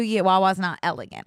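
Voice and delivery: ordinary delivery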